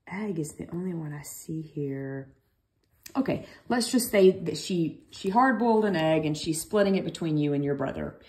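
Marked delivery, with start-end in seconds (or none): 0.1-2.3 soft whispered aside to herself. Real, not acted. Teacher checking the handout, whispering to herself. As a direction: breathe it under the breath.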